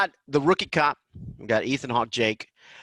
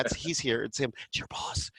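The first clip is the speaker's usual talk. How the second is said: whispered aside; 9 dB quieter than usual. Retold or imitated. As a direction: whisper it's your boss. Wife whispering about the caller.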